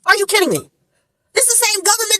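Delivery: high but contained disbelief; incredulous. Real, not acted. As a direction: repeat it in high-pitched, restrained disbelief. Repeats disbelief after a pause.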